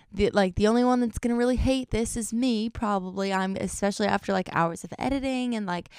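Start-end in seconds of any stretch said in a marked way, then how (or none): none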